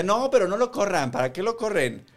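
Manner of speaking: María Zun imitation. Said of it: "y le decía".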